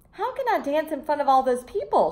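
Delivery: stage fright; frightened. Acted. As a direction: panic a little at the crowd. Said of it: "She became frightened".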